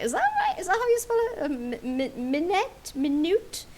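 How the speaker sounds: high, rising puzzled voice; puzzled self-questioning. Retold or imitated. Player mimics own doubt when typing minute, puzzled. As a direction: query each attempt in a high, doubting voice. Acting out puzzlement over spelling.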